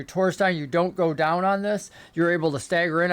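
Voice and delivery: normal talking voice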